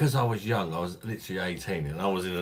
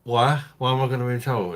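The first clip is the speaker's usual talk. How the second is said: higher, louder startled protest; retold startled question; 6 semitones higher, 8 dB louder, faster than usual. Young audience member questioning the drag queen, taken aback. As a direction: re-enact a taken-aback demand for an explanation. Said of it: "And I was like".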